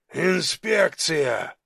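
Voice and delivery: slow, stretched-out announcement in a giant's voice; Тифон. A narrator's reading. Giant answers through the closed door, drawn-out. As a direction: draw the word out like a big official thug. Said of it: "ответил Тифон".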